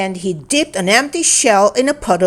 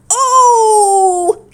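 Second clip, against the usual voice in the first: high, louder moaning oh; louder pained cry; 12 semitones higher, 4 dB louder than usual. A narrator's reading. Ava in the bush cries out again, louder. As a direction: moan out louder than the last. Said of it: "but much louder this time".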